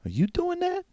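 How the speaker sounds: high, startled question; Jill's voice. Acted. Jill asks the players about the door, high and startled. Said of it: "Jill looks over and she goes".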